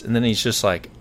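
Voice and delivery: normal talking voice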